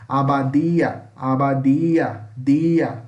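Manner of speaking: "Abadia, dia" loud-edged and slow with a plain D, "dee-a"; Northeastern-style hard D. Shown as a demonstration. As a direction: stretch "Abadia" out, no hiss on the D. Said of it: "Sabe igual o sotaque nordestino".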